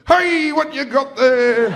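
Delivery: high, loud, drawn-out fake-casual dad; nervous dad. Dad walking over to son holding a vibrator, nervously jolly. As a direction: stretch it out, trying to sound casual. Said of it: "dad when they're nervous".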